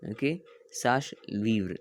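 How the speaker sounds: usual speaking voice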